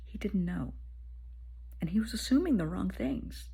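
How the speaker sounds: hushed whisper; reflective whisper. Real, not acted. Speaker whispering to viewers about the boy's confusion. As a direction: let it land softly.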